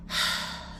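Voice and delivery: a long unvoiced weary sigh; exasperated sigh. Shown as a demonstration. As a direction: let the air out in frustration. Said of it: "sighed in exasperation".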